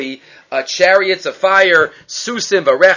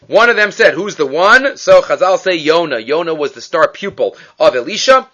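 ordinary delivery

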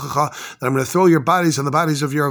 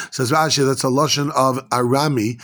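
ordinary talk

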